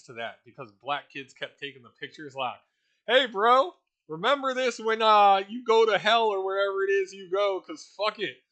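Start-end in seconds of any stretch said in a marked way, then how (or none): 3.0-8.4 mocking taunt. Kids taunting a doomed prisoner.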